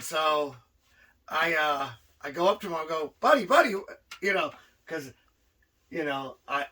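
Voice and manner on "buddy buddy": high, louder buddy-buddy plea; coaxing. Retold or imitated. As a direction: win him over as a pal. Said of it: "trying to persuade him that we were buddies".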